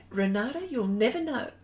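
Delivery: ordinary talk, nothing marked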